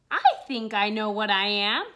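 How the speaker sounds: ordinary delivery, nothing marked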